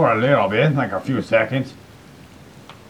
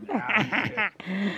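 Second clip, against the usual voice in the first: mock drawl; 10 semitones higher, 8 dB quieter, much slower than usual. Joking banter, mock drawl.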